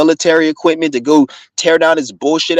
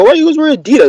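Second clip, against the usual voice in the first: high-pitched, louder mimicry of a nosy question; imitated questioner; 14 semitones higher, 4 dB louder, much faster than usual. Viewer asking why he always wears Adidas, high-pitched imitation. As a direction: imitate the asker in a much higher voice.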